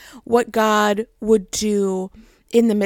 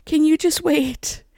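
higher-pitched, drawn-out retold plea; lightly voiced God stretching out a request for patience; God, patiently pleading; 6 semitones higher than usual. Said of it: "And God was like".

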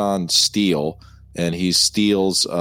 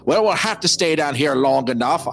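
a light attempt at an Irish brogue; lightly voiced drunk Irishman; McDermott's Irish voice; 6 semitones higher than usual. Fan trying McDermott's Irish voice for his friend. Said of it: "I'm gonna try to say it in his voice".

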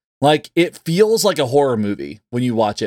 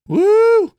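excited whoop; 4 dB louder than usual. Real, not acted. Cheering the upcoming episode.

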